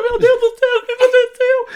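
very high mock-crying wail; retold tearful panic. Aunt on the phone crying out to nephew, high tearful wail. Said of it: "ela começou a chorar do outro".

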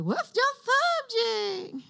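high-pitched mock questioning; squeaky-high imitation of a listener; mimicking confused listeners. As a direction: pitch it way up like a puzzled newcomer. Mimics newcomers asking her subject again.

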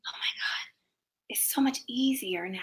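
hushed, breathless whisper of relief; awed whisper. Retold or imitated. Singer telling the interviewer about easy head voice, whispering. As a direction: whisper it like a happy secret. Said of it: "and I'm like".

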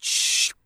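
soft whooshed shh of a sliding shutter; whispered sliding hush. Retold or imitated. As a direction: make the shutter's sliding sound. Shutter closing noise.

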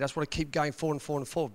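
rattled off fast; rushing. Real, not acted. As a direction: race through it, restless. Can't stand slowing down.

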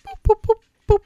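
high little boops; light, high-pitched prodding sound effect; playful imitated pokes; poking noise. Imitates stick poking the snakes.

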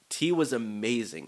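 ordinary talk

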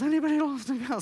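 calling out. Retold or imitated. Bernie calling around the street.

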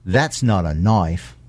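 low, slow mock Australian drawl; Crocodile Dundee quote. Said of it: "movie references from nineteen eighty-six".

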